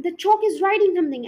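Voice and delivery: high, loud, fast shriek; frantic shriek; scream. A narrator's reading. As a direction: blurt it out in a panic. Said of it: "The scream".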